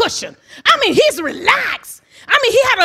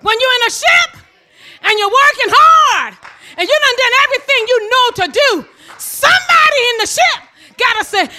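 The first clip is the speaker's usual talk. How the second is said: ordinary talk, nothing marked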